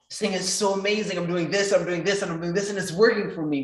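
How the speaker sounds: high, loud and eager; excited update. Retold or imitated. Young man telling his mentor good news, high and eager. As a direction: brag happily about progress.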